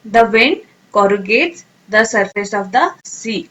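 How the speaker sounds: the wind corrugates the surface of the sea read very slowly and clearly; careful teacher read of the example sentence; slow model sentence. Shown as a demonstration. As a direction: read it out word by word for learners. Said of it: "sentence में कैसे use करेंगे".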